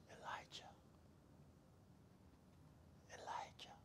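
unvoiced whisper calling Elijah; God's whisper. God calling Elijah softly, in a whisper. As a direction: breathe his name, barely there. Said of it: "God whispers".

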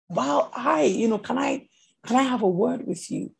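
soft, tentative request for a word; tactful approach. Acted. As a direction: approach a stranger gently, softly. Models gently approaching the person.

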